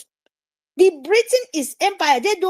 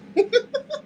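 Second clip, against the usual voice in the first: high laugh; 8 semitones higher than usual. Real, not acted. Laughs at own joke.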